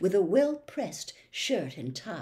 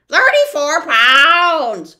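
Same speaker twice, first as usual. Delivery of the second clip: very slow, loud, high shriek; 9 semitones higher, 17 dB louder, much slower than usual. Acted. The bird shrieks back into the fog, loud. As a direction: shriek it as you fly off. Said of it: "shrieked the bird".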